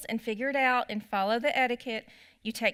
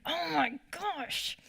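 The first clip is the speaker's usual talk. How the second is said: higher, slow, drawn-out disbelief; exasperated; 5 semitones higher, 4 dB quieter, much slower than usual. Retold or imitated. Mom retelling her reaction to a lady who always cut, slow exasperated disbelief. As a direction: sigh it out, fed up with her again. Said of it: "that I was just like".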